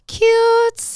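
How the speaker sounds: high, loud squeal of cute; gushing cute. Acted. Sister gushing over a new blush, high squeal. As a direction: squeal it with delight.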